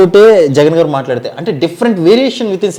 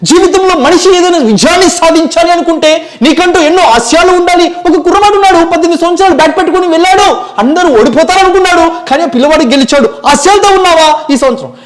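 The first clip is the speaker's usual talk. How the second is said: motivational speech; 12 semitones higher, 5 dB louder than usual. Retold or imitated. Motivational speaker impression.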